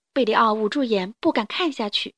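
usual speaking voice

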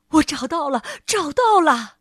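loud joyful cry; excited shout. A narrator's reading. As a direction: shout it out with joy. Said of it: "国王兴奋地大声喊道". (兴奋地大喊)

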